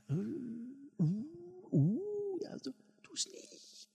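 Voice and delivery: high whispered warning; the inner voice hissing a warning to a boy stealing; eerie whisper. Pretend. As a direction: whisper it high like a ghostly conscience.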